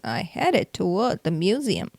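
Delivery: ordinary delivery, nothing marked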